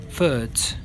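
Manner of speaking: ordinary delivery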